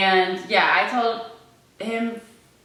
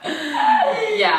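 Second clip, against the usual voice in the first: real high laughter; 8 dB louder than usual. Real, not acted. Girlfriend laughing while telling the coming out story. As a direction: laugh out loud, high and bright, into a yeah.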